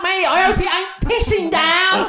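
loud, high mock Cockney [name] announcement; [name] impression. Host mocking [name] announcing her Hyde Park shows, loud Cockney. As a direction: belt it like a brash Cockney [name]. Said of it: "She crashed websites just making".

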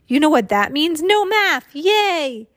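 put-on high, loud kid's celebration; excited cheer. A narrator's reading. Students celebrate skipping math, excited cheer. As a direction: build to a gleeful cheer.

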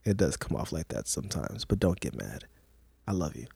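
smooth it over quietly and low; soft placating. Real, not acted. Placating partner after imitating her.

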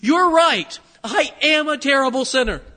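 out loud. Retold or imitated. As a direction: throw it back loudly at the accuser. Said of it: "I said out loud to him".

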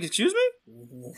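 slow, high-pitched incredulous question. Retold or imitated. Patient hearing of a surprise fluoride charge, incredulous. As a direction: draw it out in acted disbelief.

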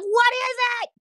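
high-pitched, exasperated question; exasperated outcry. Retold or imitated. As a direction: cry it out high, baffled and fed up. Retold exasperated younger self.

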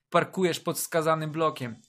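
ordinary delivery

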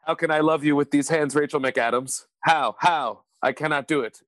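stilted mock American melodrama; over-careful fake American accent; Benedict Cumberbatch. Host joining the Doctor Strange bit, stilted American accent. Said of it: "had to do an American accent".

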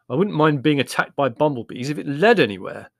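a hint of an exasperated brother; lightly voiced Larry, aggrieved.

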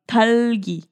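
talgui in a gringo accent; American accent Spanish. Shown as a demonstration. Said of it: "lo hice con acento gringo".

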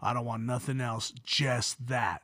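very low, soft monotone; deadpan little niece. Retold or imitated. Little niece telling uncle her ambition, hushed deadpan. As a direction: say it low and quiet, dead serious.

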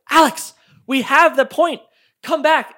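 high, loud yell. Retold or imitated. Finally yelling at teammate to come back, loud. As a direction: yell it across the map.

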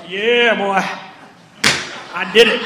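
loud mock gloating; boastful brag. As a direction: crow over it like you did it yourself. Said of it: "you're like".

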